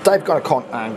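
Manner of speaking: ordinary delivery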